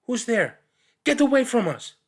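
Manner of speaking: high, loud cry from the dark; scared child shouting a warning; frightened shout. Acted. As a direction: yell out in fear at the unknown.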